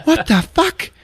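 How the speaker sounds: high, loud laughing outburst; mock shocked shriek. [name] finding the cocoon, mock shocked shriek. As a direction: shriek it in disbelief. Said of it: "she finds the Dottie cocoon".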